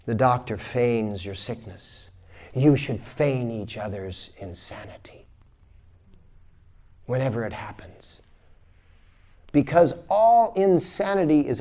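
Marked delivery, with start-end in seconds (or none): 2.5-8.2 soft whispered advice. Real, not acted. Teacher confiding the key lesson to listeners, whispered. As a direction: lean in and whisper it.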